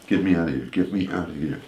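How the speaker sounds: low, hushed plea to a producer; retold under-the-breath appeal for rescue; urgent aside. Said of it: "I said to the producer".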